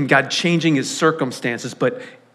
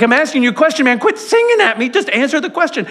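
much higher, exasperated; mock irritation; 9 semitones higher, faster than usual. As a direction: play a fed-up friend losing patience. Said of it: "I was so irritated".

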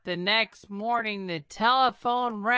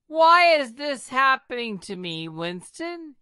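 higher, louder, pleading outburst; anguished cry; 6 semitones higher, 4 dB louder than usual. A narrator's reading. King crying out to Churchill in distress, higher and louder. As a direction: break out in despair. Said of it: "he cried".

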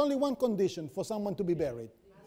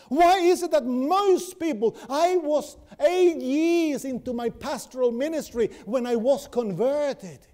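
louder, rising sermon climax; full-force preaching; 5 semitones higher, 4 dB louder than usual. Acted. Preacher urges friends toward resurrection life, loud fervent preaching. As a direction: preach it out with fire and conviction.

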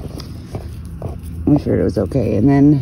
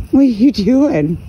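high pet talk; 8 semitones higher, 4 dB louder than usual. Real, not acted.

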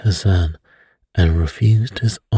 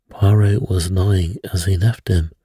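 ordinary delivery, nothing marked

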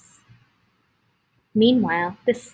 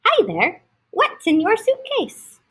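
high, bright call; eager greeting; 9 semitones higher, 4 dB louder than usual. Acted. Curious animal greeting the stranger, high and eager. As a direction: greet him with big curiosity.

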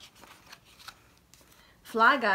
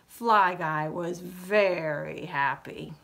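usual speaking voice

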